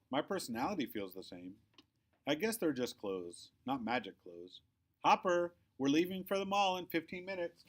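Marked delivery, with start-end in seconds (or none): 5.0-7.6 high, loud call from another room. A narrator's reading. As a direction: call up the stairs like Mom. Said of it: "calls Mom".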